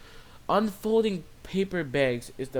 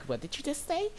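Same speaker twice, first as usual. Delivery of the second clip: affronted Karen; 11 semitones higher, 6 dB quieter than usual. Retold or imitated. Customer overhearing the cashier's complaint, affronted. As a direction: mimic her turning back, pitched way up. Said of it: "she's like".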